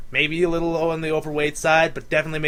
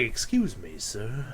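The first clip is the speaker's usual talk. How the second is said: deep sensual customer; 7 semitones lower, 8 dB quieter, slower than usual. Retold or imitated. Impersonating the drive-thru customer for the co-host, deep sensual voice. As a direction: lower and softer, a smooth seductive man. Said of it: "deepest, most sensual voice we had ever heard".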